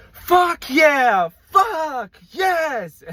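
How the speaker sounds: high, loud triumphant whoop; man freed from jury duty whooping high and loud; gleeful cheer. Retold or imitated. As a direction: cheer like you just escaped jury duty. Juror cheering as he leaves.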